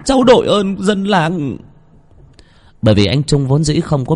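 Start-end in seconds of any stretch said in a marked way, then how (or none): 0.0-1.6 put-on high, pleading young man's plea to the village elders; pleading son. A narrator's reading. Trung begging the villagers to find his father, high and pleading. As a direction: beg the villagers for help, voice pitched up and anxious.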